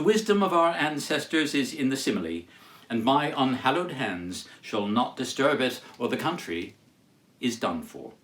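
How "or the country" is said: usual speaking voice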